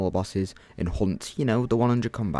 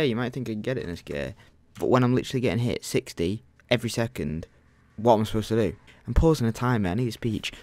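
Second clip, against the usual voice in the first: ordinary talk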